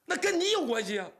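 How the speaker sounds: high, loud accusation; furious retort; angry outburst. Acted. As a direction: snap the blame on him. Father turns on son-in-law.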